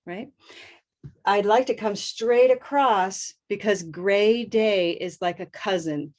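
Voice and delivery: ordinary delivery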